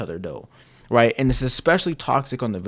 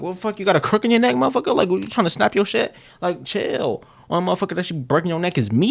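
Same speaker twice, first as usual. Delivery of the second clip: higher, fast mock-jealous rant; possessive girlfriend; 6 semitones higher, faster than usual. Possessive girlfriend scolding her partner for looking away, higher and fast. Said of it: "she always, like".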